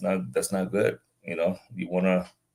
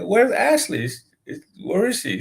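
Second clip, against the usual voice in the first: much higher-pitched, pressing repeat of the question; insistent question; 12 semitones higher than usual. Retold or imitated. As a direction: press the upset caller for an answer. Repeats question, cutting through rant.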